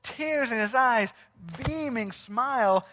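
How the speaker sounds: usual speaking voice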